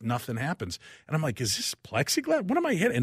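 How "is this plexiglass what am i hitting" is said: baffled. Retold or imitated. Baffled window won't break.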